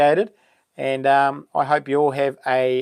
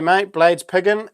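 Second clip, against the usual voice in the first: ordinary delivery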